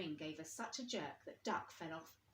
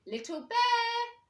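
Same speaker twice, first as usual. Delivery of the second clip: long, loud, high call; calling loudly; 12 dB louder, much slower than usual. A narrator's reading. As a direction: shout his name across the room. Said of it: "climbed up on the chair and called".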